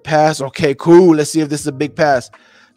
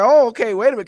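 higher-pitched replay of sudden interest; a little excited; 6 semitones higher, much faster than usual. Retold or imitated. Streamer retelling his reaction to the throw, a little excited. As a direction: re-enact your own mildly excited double take. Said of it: "I got a little excited".

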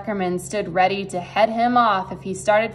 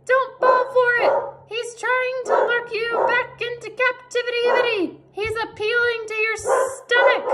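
very high squawking goose voice; goose warning; 14 semitones higher than usual. A narrator's reading. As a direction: warn urgently as a high-pitched goose. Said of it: "said the goose".

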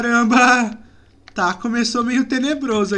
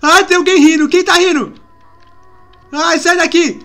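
loud, higher-pitched frightened cry; alarmed; 4 semitones higher, 5 dB louder than usual. Real, not acted. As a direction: panicky questioning of a strange sound. Hears laughter, frightened in game.

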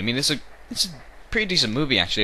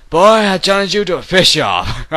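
high, loud put-on challenger; hammed-up duel challenge; silly challenge voice; 8 semitones higher, 10 dB louder than usual. Mock challenge.

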